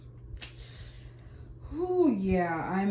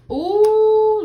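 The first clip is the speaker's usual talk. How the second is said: 1 high ooh; 14 semitones higher, 14 dB louder than usual. Real, not acted. Reacting to the Ten of Swords card.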